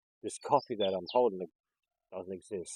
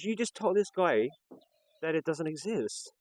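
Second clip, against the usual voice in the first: high, loud, indignant mock yell; retold yelling; 8 semitones higher, 4 dB louder than usual. Man by the fridges calling out a contradiction, retold yelling. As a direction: call it out as if across a shop. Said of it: "he's yelling out from the back of the server".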